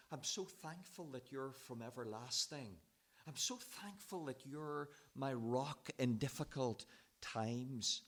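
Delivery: soft, thankful, content reading of the verse; grateful reading. Shown as a demonstration. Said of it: "I don't think he's saying it like that".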